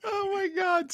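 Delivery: ordinary delivery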